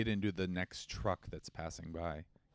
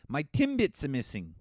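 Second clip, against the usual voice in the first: higher, louder mock Canadian; a hammed-up Canadian whine; mock Canadian accent; 6 semitones higher, 5 dB louder than usual. Host mocks a Canadian accent, raised up. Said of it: "or like".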